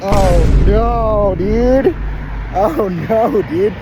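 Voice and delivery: high, loud cries of dismay; horrified dismay. Real, not acted. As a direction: wail it out, grossed out and appalled. Horrified by mess on street.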